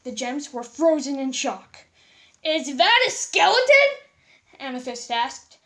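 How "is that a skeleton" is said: loud, high shocked question. A narrator's reading. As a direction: gasp it out in shock. Gems shocked.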